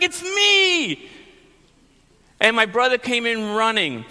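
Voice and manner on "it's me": high, pleading shout; exasperated shout. Retold or imitated. Boy pleading with his screaming sister, exasperated shout. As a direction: cry out in exasperation. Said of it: "And I'm like".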